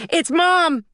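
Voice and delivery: put-on high, loud child's yell; excited yell. A narrator's reading. Girl yells to family that Mom is calling, high excited shout. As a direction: shout it through the house. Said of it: "and then yelled".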